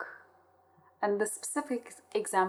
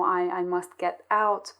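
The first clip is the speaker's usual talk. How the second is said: normal talking voice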